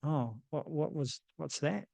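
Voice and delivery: soft, hesitant retold reply; quiet puzzlement. Retold puzzled reaction to mum.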